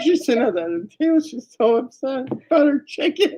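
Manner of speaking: high, fast words breaking into laughter; laugh-cry it out; telling it while helpless with laughter. Real, not acted. Laughing retelling.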